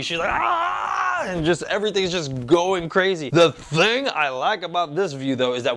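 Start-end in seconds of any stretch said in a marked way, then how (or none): 0.3-0.9 Super Saiyan power-up yell. Retold or imitated. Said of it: "She's just like Broly".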